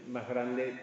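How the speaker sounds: ordinary talk, nothing marked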